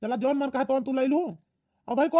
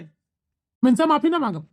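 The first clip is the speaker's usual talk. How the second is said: slow, louder and deliberate; slow defiant refusal; 6 dB louder, slower than usual. Acted. Son refusing his mother's demand for an apology, slow and loud. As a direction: spell out every word in defiance.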